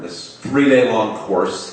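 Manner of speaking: ordinary delivery, nothing marked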